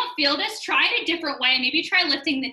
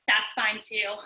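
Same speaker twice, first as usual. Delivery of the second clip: ordinary delivery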